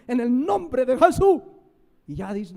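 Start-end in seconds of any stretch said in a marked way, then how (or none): 0.1-1.4 loud mock shout of the formula. Preacher imitating a pastor shouting over the sick, loud mock shout. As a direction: belt out the name like a showy pastor. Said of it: "Así, y grita".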